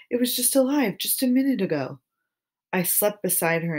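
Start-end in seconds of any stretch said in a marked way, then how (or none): 0.1-2.0 sing-song voice. A narrator's reading. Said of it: "in the same sing-song voice".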